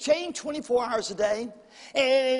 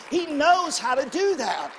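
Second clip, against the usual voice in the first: slow, loud, higher preacher shout; preaching shout; 4 semitones higher, 3 dB louder than usual. Real, not acted. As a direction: hammer it home slowly with a shout. Preacher repeats line, shouted first.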